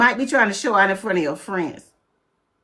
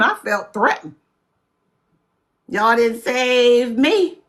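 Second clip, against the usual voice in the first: ordinary talk, nothing marked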